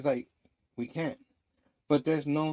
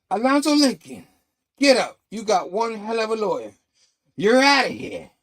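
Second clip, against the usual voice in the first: loud, high announcement to an inmate; prison guard calling; 6 semitones higher, 7 dB louder than usual. Retold or imitated. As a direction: call it out across the cell block. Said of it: "prison guard, uh, interrupts them".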